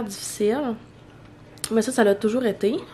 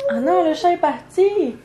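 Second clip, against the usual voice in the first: higher, slower startled cry; 8 semitones higher, 3 dB louder than usual. Real, not acted. Mom noticing the cat run off mid-vlog, startled cry. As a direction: caught off guard, call it out.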